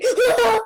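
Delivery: sudden high scream; short, loud shriek of alarm; loud frightened scream. Real, not acted. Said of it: "It's scary".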